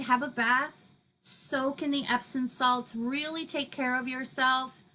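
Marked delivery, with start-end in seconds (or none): none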